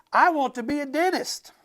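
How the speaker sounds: high, loud excited cry; a child shouting her dream. Acted. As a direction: shout it out like an eager kid.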